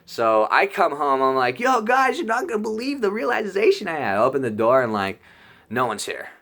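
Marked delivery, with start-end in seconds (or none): 1.6-4.2 excited call. Retold or imitated. Bursts in excited to roommates.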